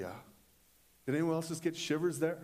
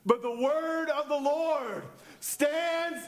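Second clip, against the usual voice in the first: full-force preaching; 5 semitones higher, 7 dB louder than usual. Real, not acted. Preacher rallying the congregation to stand firm, full-force proclamation. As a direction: build to a high, shouted climax.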